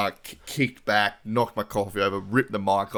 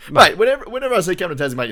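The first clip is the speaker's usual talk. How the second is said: excited outburst; 11 semitones higher, 11 dB louder, faster than usual. Real, not acted. Excited rant at mate's teasing.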